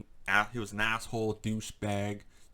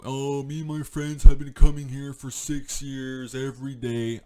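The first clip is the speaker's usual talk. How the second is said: raised mocking quote of the entitled guy; mocking the old man; 5 semitones higher, 13 dB louder than usual. Vlogger mimicking the entitled old man, mocking. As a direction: sneer it out like a smug old regular. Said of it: "he went off and on about how".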